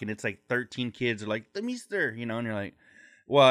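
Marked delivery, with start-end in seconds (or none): 1.5-2.1 put-on high pleading kid voice; begging kid. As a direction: call out like a begging child. Kids begging.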